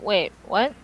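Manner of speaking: ordinary delivery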